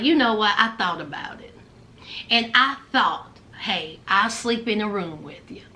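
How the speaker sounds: low, soft, measured man's voice; Usman. Retold or imitated. Man agreeing to share the room, low and soft. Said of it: "Usman was like".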